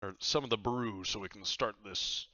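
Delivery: pirate captain. Acted. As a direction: boom it out like a cheery pirate host. Captain Myers voice again.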